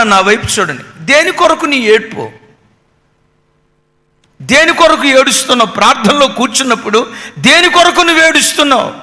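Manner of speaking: ordinary talk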